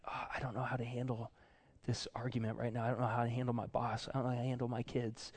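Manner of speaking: soft, quick mock fretting; overwhelmed muttering. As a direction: rattle off worries under the breath. Acts overwhelmed quiet prayer.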